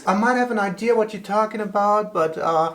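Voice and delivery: put-on high, quick, overly polite British accent; a hammed-up British charm, darling and all; mock polite British accent. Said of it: "Why do you get a British accent when you're trying to be polite".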